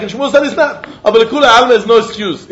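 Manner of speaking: ordinary delivery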